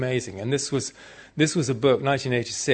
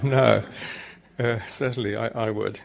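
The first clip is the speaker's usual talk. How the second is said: usual speaking voice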